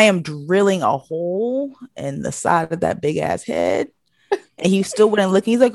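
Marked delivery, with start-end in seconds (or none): none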